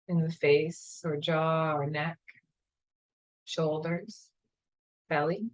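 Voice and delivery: calm, unhurried meditation guide voice; guided meditation. Acted.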